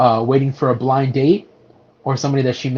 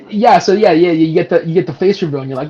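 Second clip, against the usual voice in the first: ordinary delivery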